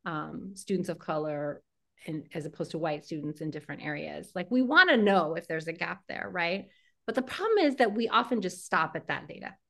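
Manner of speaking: normal talking voice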